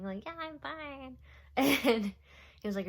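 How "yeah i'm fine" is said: higher, softer, mock-brave "Yeah, I'm fine"; tearful "I'm fine". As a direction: pretend you're fine while crying, pitched up and quiet. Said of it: "Like, literally crying".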